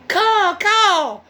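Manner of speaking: high loud cawing crow. A narrator's reading. Crow warns of the fox with a high loud caw. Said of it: "called the crow".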